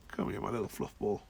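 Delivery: low, soft pet talk; gentle murmur to a fluffy cat; soft cooing. Real, not acted. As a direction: coo tenderly at the cat.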